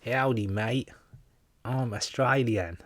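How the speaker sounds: hammed-up, drawn-out Australian accent. Host doing Australian on a viewer's request, mock Australian accent. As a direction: put on a broad mock Australian twang. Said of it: "do Australian".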